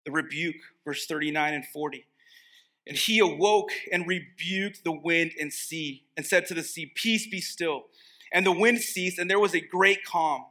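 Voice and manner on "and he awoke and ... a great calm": raised, measured Bible reading voice; scripture reading. Acted. Preacher reading the passage aloud to the congregation, raised and measured. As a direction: read the verses out to the church.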